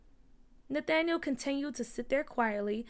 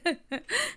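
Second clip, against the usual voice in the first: higher-pitched little laugh; short chuckle; 8 semitones higher than usual. A narrator's reading. Mother jokes that Junior isn't tough, short chuckle. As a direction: chuckle knowingly after a quip.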